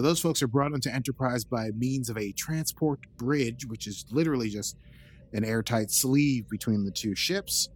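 ordinary delivery

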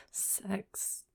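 breathy whisper. Pretend. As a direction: whisper it slyly. Whispered one-word comment.

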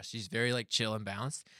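ordinary talk

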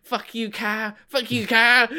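mock the kids cursing Kyle out; rude kids. Kids turning on Uncle Kyle, high bratty taunt. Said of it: "all the kids are just like".

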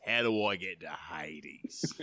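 slow, low Aussie drawl; low, hammed-up Aussie voice; broad Aussie accent. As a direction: lay the Australian accent on thick and slow. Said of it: "there's the Aussie accent".

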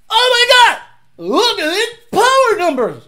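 very high, loud, hammed-up awe; mock gasp of amazement. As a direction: overdo the dyno-graph wonder. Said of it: "and you went".

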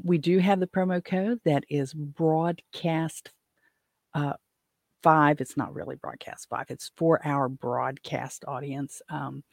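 normal talking voice

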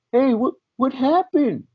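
put-on high, slow little boy asking; kid voice. Kids' voice imitated.

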